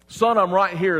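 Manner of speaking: loud, high retold reassurance; insistent father. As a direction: call it out as an acted dad answering yet again. Father answers the repeated call.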